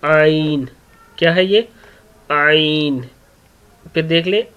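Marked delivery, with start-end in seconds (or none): 0.1-0.7 आइन from the middle of the throat; Arabic ع from the throat; throat letter demo. Shown as a demonstration. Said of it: "दरमियानी हिस्से से ये लफ्ज़ अदा होता है आइन". 2.3-3.1 आइन from the middle of the throat; Arabic ع from the throat; throat letter demo. Shown as a demonstration. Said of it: "दरमियानी हिस्से से ये लफ्ज़ अदा होता है आइन".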